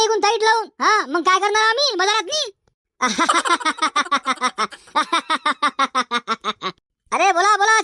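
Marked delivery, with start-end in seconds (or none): 3.0-4.7 hearty laugh. Acted. Merchant laughs at buyer's complaint.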